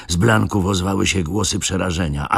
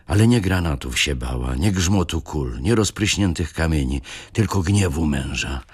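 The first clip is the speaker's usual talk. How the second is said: ordinary delivery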